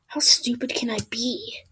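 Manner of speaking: whispered self-scolding. Real, not acted. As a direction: hush it to yourself. Self-mocking aside.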